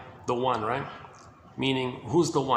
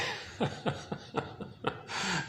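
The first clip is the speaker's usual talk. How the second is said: breathy laugh; 5 semitones higher, 7 dB quieter than usual. Real, not acted. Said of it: "laughs at own moaning".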